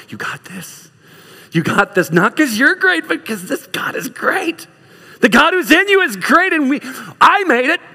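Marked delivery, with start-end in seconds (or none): 0.1-0.9 very soft, near-whispered cheer; hushed encouragement. Acted. Watching witness to a struggling believer, hushed encouragement. 2.1-7.8 high, urgent preacher's encouragement; fervent preaching. Real, not acted. Preacher urging church that God is great, fervent preaching.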